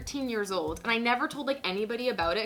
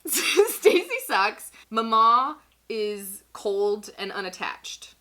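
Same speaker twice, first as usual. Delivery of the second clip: each word drawn out slowly, louder; slow emphatic summary; 6 dB louder, much slower than usual. Acted. To her friend, recapping the family, slow and emphatic. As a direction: spell out the verdicts slowly like a list of facts. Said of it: "what we've established is".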